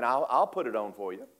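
ordinary talk

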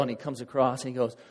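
ordinary talk

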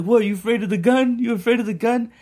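high-pitched imitation of a taunting dealer; mock taunting. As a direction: goad him in a high, put-on voice. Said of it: "he was like, he's like".

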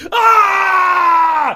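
long, high, loud scream; drawn-out comic yell; mock rage scream. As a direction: let out a hammed-up "Aaah". Mock scream at breaking golf clubs.

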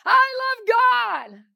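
slow, high, loud declaration; joyful shout. Pretend. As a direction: ring it out triumphantly. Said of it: "you're going".

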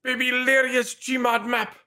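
high, hyped announcement; mock announcer. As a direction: shout the channel name like an intro. Announcing a mock channel title.

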